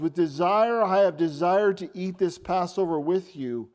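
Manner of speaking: loud, driving sermon delivery; emphatic preaching. Real, not acted. Preacher expounding Jesus' Last Supper words, forcefully emphatic. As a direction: hammer the verse home to the room.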